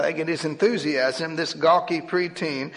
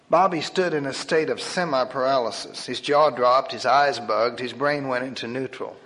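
ordinary delivery, nothing marked

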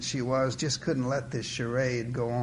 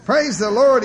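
loud, higher-pitched outburst; blurted out; 8 semitones higher, 11 dB louder than usual. A narrator's reading. Churchgoer blurting praise on autopilot, loud blurt. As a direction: blurt it out loudly. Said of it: "he blurted out".

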